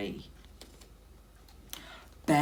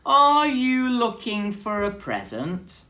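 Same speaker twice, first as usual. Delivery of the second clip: higher, louder probing question; half-teasing bear question; suspicious bear; 11 semitones higher, 11 dB louder than usual. Acted. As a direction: catch the mouse out. Bear questions hiding Mouse.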